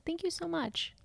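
tiny, high, whispery voice; imitated sweet child. Mom imitating daughter thanking her for water, sweet tiny voice. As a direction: softly mimic a grateful little kid.

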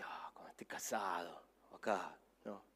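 a sly whisper to himself; whispered thought. Retold or imitated. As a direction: whisper it like a secret plan. Retold private thought about the tent.

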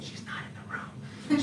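slow, hushed joking aside; whispered aside. Real, not acted. Pastor joking to congregation that Andrea isn't in the room, whispered. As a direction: lean in and whisper it like a secret. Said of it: "joking aside about Andrea".

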